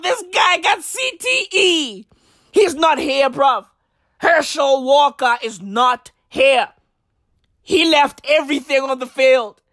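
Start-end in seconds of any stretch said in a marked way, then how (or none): none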